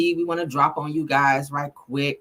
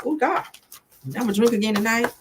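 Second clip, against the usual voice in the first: normal talking voice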